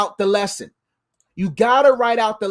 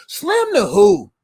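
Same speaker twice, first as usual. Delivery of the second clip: slow challenge; 3 semitones higher, much slower than usual. Real, not acted. Rhetorical challenge.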